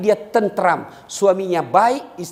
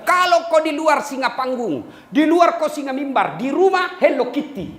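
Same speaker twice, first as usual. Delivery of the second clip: quoting his wife; 6 semitones higher than usual. Retold or imitated. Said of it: "Dia bilang".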